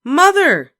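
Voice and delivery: high, loud cry for mother; cried out. A narrator's reading. Beezus calling her mother, loud and outraged. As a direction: shout for help, outraged. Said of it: "cried Beezus".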